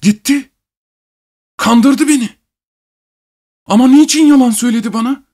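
very high-pitched, desperate cries; anguished shouting. A narrator's reading. Betrayed husband rails at his wife's lie, shouting in anguish. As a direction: cry out in despair, voice pushed right up high. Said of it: "sallayarak bağırmaya başladı".

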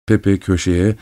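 usual speaking voice

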